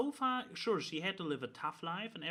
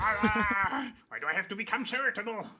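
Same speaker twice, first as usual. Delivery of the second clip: whiny complaint; 6 semitones higher, 6 dB louder than usual. Acted. Mock complaint of the character.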